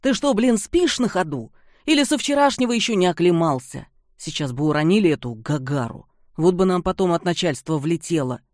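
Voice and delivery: loud, fast irritated scolding. Acted. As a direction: chew him out fast. Antipov scolds partner.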